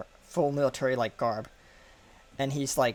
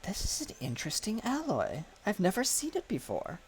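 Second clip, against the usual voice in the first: higher, softer, inquisitive voice; curious girl's voice; 8 semitones higher, 5 dB quieter than usual. Acted. Curious girl examining the ship's strange metal, asking softly. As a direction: peer at it and wonder aloud. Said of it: "she says".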